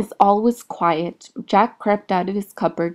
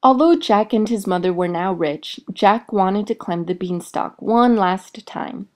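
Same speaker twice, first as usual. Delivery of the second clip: ordinary delivery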